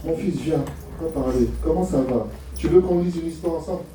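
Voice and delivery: low, softened fatherly invitation; acted tender dad, voice dropped; gentle father. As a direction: draw your son in calmly. Father gently speaking to son.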